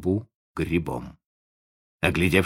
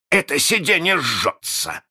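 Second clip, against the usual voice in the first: raised, startled bark of complaint; roared; 8 semitones higher, 3 dB louder than usual. A narrator's reading. Hook complains to his bosun of a burning seat, roaring. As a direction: roar it as your seat burns. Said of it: "рявкнул он".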